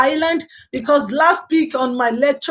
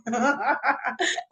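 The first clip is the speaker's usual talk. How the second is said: soft chuckling laugh; quiet run of amused laughter; 8 dB quieter than usual. Real, not acted. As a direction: chuckle softly in sympathy. Amused sympathy with viewer's comment.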